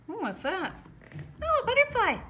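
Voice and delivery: high, excited squeaky puppy, delighted; curious puppy voice. Acted. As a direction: gasp at the butterfly like an eager puppy. Voicing curious puppy Ollie.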